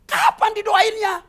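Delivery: high, loud taunting question; mocking shout. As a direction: jeer at her impatiently. Parents mocking demand.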